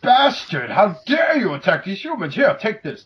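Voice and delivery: over-the-top gallant villain-scolding; mocking a robot's cheesy lines; hammy hero speech. Parodying Jazz's hammy battle speech.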